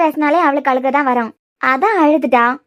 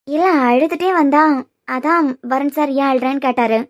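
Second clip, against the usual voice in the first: ordinary talk, nothing marked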